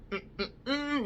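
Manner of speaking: closed-mouth "mm-mm-mm" of refusal, high and soft; soft, high hummed head-shake noises; disapproving mm-mm. Retold or imitated. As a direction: hum a quiet no, imitating yourself. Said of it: "shaking my head".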